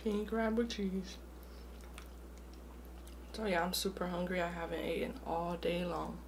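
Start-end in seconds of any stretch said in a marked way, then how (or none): none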